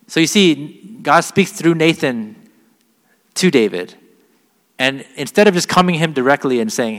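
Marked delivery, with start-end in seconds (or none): none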